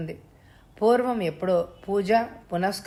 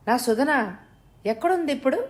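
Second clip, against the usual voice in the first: high, rushed and anxious; anxious question; 6 semitones higher, faster than usual. Acted. Mother asking kidnapper where her daughter is, anxious and high. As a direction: ask in a panic for your daughter. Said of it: "ఆతృతగా ప్రశ్నించింది".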